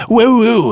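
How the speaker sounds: loud high vocal beatbox hoots; [name] beatbox. Pretend. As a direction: hoot like a train whistle, loud. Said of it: "We gotta hear this impression again".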